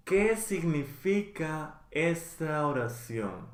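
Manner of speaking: slow, each syllable clear for learners; slow, careful model. Shown as a demonstration. Teacher slowly modeling the question for learners, careful and clear. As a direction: spell it out slowly so students can follow. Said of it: "teacher models phrase twice".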